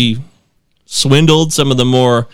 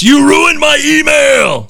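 high, slow, drawn-out mock-furious yell; hammed-up outraged holler; all-caps shout; 11 semitones higher, 3 dB louder, slower than usual. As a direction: bellow it like an email typed in capitals. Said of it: "says in all caps".

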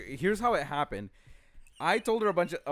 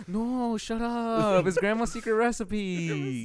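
higher protest, "grandma's secret recipe" drawn out slowly; emphatic mock insistence; 5 semitones higher, slower than usual. Imitating Danny defending the KFC potatoes, emphatic insistence. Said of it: "you put the emphasis on grandma's secret recipe".

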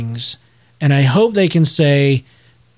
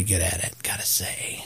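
whispered aside; 9 semitones lower, 15 dB quieter than usual. Real, not acted. Quiet aside.

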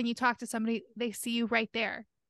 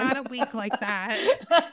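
laughing; much slower than usual. Real, not acted. Laughing at wink demo.

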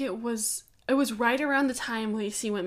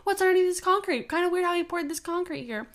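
high-pitched mock accusing TV host; needling suspicion in a raised impression voice; Nancy Grace; 7 semitones higher than usual. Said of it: "and she's like".

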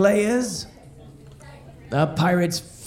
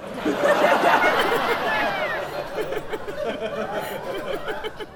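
long high-pitched giggle; high squeaky laugh; 5 dB quieter than usual. Real, not acted. Laughing at own joke.

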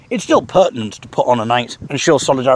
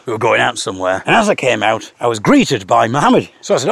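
ordinary delivery

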